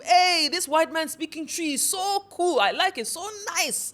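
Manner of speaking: high, loud, delighted gushing; gushing WhatsApp forward. Retold or imitated. Ghanaians sharing the video in a group chat, high delighted gushing. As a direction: rave about it to your friends. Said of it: "forward it to a WhatsApp group".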